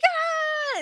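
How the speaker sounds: high drawn-out whoop; elated whoop. Retold or imitated. Runner answering her cheering friends, elated high whoop.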